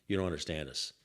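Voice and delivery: low, lightly mimicked Italian-accented goon; heavy Italian accent. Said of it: "with heavy accent".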